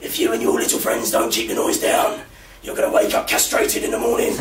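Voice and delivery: rasping, voiceless monster-mum threat; demonic mother. Retold or imitated. Mum in the doorway warning her son, demonic rasp. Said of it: "looking into the depths of hell".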